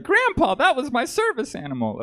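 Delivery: high-pitched mock-aghast whine; protesting. Grandchild to grandpa over the shot dog, high mock-distressed protest. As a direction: cry out in squeaky, exaggerated protest. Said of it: "I was like".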